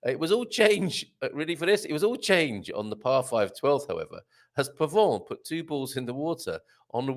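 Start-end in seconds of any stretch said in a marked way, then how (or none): none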